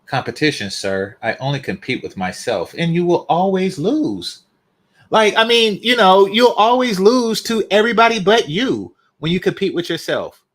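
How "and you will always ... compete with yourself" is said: loud, higher mocking retort. Real, not acted. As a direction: rub it in, loudly. Mocking a chatter's claim.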